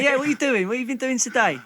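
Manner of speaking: lightly acted London-accented greeting; picked-up London accent. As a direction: chat at home in borrowed Cockney. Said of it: "just picked up the accent".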